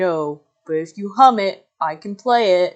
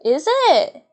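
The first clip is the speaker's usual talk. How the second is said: high squeaky voice; 10 semitones higher, 5 dB louder than usual. Acted.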